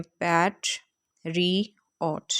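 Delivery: पैट, री, ऑट as three separate beats; split into syllables. Shown as a demonstration. Teacher shows learners the syllables, saying पैट, री, ऑट one by one. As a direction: break पैट, री, ऑट apart with clear pauses. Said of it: "उस तरह से बोलना one by one".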